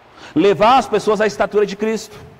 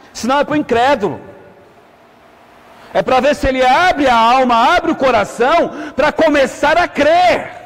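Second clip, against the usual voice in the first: higher, forceful pulpit delivery; preaching at full force; 4 semitones higher than usual. Real, not acted. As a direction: drive it home to the congregation, stretching the last words.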